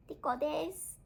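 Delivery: high, soft little dog voice; cute high pet introduction; voicing a pet dog. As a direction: speak softly for the puppy. Speaks for her dog.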